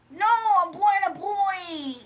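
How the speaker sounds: high, loud, slow whine; drawn-out offended protest; mock Dimitri answering a cis man question; Dimitri impression. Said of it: "He don't even understand stuff".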